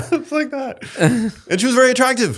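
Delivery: laughing. Real, not acted. Laughing after the Oy vey bit.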